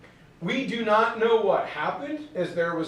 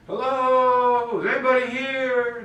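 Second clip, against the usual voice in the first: calling out; 5 semitones higher, 3 dB louder than usual. Acted. Newcomers calling into an empty land, calling out. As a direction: call out as if into empty country.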